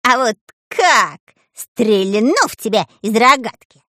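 high-pitched, feisty threat; threatening. A narrator's reading. Dyrka takes out his slingshot, threatening. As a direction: threaten with squeaky bravado. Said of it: "пригрозил шпион Дырка".

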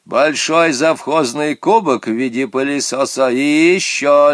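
strict stranger issuing instructions; stern phone voice. A narrator's reading. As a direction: give orders sternly down the telephone. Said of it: "строго говорил Ирине Вадимовне".

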